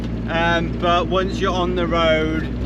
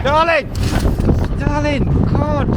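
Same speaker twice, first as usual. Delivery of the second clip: shocked shout; 10 semitones higher, 4 dB louder than usual. Real, not acted. Wife just got run over.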